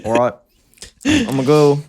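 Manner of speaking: little British lad, put on; flustered mock Liverpool accent. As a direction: stammer it like a British boy. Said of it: "Like a little boy".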